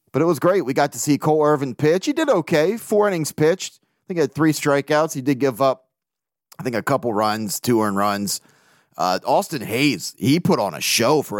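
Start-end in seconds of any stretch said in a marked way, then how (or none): none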